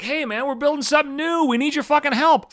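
high, mock-friendly fundraiser pitch; a college fundraiser sweet-talking an alumnus, mock cheerful. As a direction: ham up the college asking for money. College begging alumni for donations.

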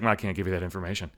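dismissive call-centre agent; curt operator. Retold or imitated. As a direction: rattle off the refusal flat and quick. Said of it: "She's like".

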